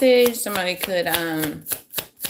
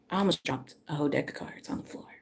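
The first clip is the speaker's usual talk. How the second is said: whisper; 4 semitones lower, 10 dB quieter, faster than usual. Real, not acted. Nearly dropping cards on live.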